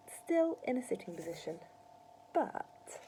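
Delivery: ordinary delivery, nothing marked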